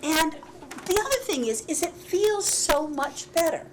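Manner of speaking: ordinary talk, nothing marked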